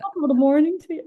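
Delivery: badly faked Irish accent; attempted Irish accent. Guest trying the host's suggestion, poor Irish accent. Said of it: "No, I can't do that".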